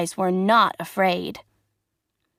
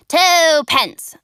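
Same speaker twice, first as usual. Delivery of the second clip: calling out; 7 semitones higher, 6 dB louder than usual. Acted. Showman calling out.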